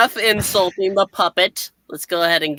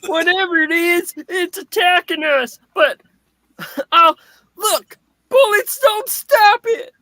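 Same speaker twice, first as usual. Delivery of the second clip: higher panicked cry; 12 semitones higher, 3 dB louder, slower than usual. Acted. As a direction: shout in alarm at a monster. Panicked bystander in comic.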